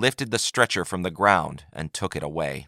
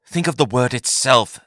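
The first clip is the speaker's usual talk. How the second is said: higher, patient voiced Yagar; Yagar explaining; 4 semitones higher, 4 dB louder than usual. A narrator's reading. Yagar guides Taith, higher and patient. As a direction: coax her toward the meaning. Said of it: "said Yagar".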